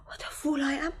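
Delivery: whispered, dazed self-reproach; stunned whisper. Acted. Wife whispering, stunned by her husband's guilt.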